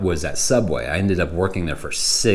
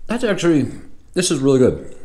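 mouth full; 7 semitones higher, faster than usual. Real, not acted. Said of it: "trying not to talk with my mouth full".

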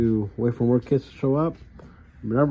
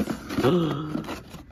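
excited gasp; 4 semitones higher than usual. Real, not acted. Finds a chase car.

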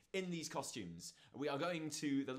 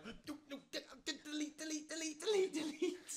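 very high, frantic squeal of a retold panic; hammed-up embarrassed panic, pitched way up; mock panic; 14 semitones higher than usual. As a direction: stammer it high, repeating in a fluster. Retelling panic on a crowded bus.

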